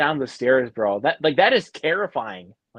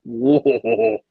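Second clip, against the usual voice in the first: high, drawn-out gasp of awe; mock awed whoa; 12 semitones higher, 4 dB louder than usual. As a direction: react like a chill just ran down your spine. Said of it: "I was like".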